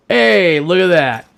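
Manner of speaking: loud excited call; happy discovery, say it out loud. Real, not acted. Finds a gun in game.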